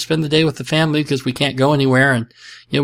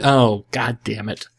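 defeated groan; 3 semitones lower, 7 dB quieter than usual. Real, not acted. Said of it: "realizing he fell for joke".

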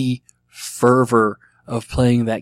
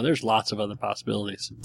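ordinary delivery

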